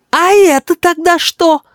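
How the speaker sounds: very high, slow triumphant challenge; accusing little boy. Acted. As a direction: confront grandpa in a piercing child voice. Boy produces evidence, accusing.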